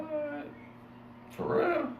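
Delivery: high squeaky disbelieving what; shocked disbelief. Retold or imitated. Hearing the crew talked about him, stunned squeak. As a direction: jump way up in pitch, stunned.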